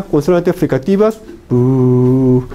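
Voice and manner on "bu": "bu" stretched into one long held sound; long, level "bu" as a sound demo; fricative demonstration. Shown as a demonstration. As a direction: hold "bu" steadily to show partial closure. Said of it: "hay una oclusión parcial".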